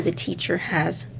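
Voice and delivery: usual speaking voice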